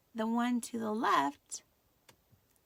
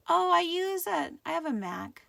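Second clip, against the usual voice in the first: high, loud, eager exclamation; excited reply; 7 semitones higher, 9 dB louder than usual. Real, not acted. Teacher answering a student with excited surprise. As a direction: burst out with delight.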